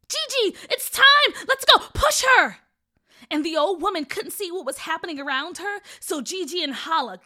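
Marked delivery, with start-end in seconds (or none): 0.1-2.6 urgent high, loud yell; screaming. A narrator's reading. Boy urging his sister to act, screamed. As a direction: shout it as a frantic command. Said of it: "screamed to his sister".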